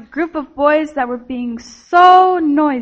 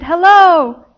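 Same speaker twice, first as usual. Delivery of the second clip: loud, higher-pitched drawn-out hello; calling out; 5 semitones higher, 9 dB louder than usual. A narrator's reading. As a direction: call through the house for an echo. Girl calls into every room.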